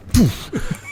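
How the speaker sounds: high unvoiced poof; imitated punch noise. Manager punching young player, breathy poof sound.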